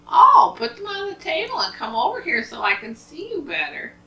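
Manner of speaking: wolf's high-pitched grandma disguise; wolf posing as Grandma. Acted. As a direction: coax her closer in a high fake voice.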